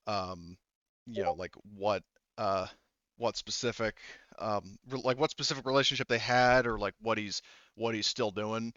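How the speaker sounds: usual speaking voice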